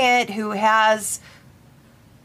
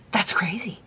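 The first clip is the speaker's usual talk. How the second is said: imitated whisper; 5 dB quieter than usual. Client's scared reply, retold.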